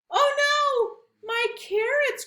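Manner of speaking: mock cry. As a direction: wail over lost carrots. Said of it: "cried Rabbit".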